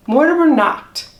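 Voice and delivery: usual speaking voice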